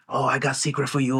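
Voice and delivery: lightly voiced old Chinese lady; playful mock-accent shopkeeper; imitating a Chinese accent. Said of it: "She goes like".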